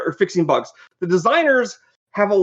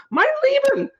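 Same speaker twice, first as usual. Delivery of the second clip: mock death cry in German, much higher; dying German soldier. Developer imitating the garbled German death cry, much higher. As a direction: squeal it out like a shot game soldier. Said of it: "The only thing they could understand from the Germans".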